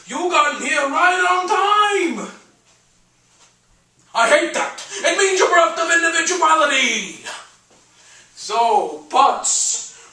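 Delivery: big theatrical stage voice, high and drawn out; loud, expansive eccentric host; brash painter greeting an interviewer. Acted. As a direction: hold court, swooping up on the welcomes. Plays Zero Mostel greeting an interviewer.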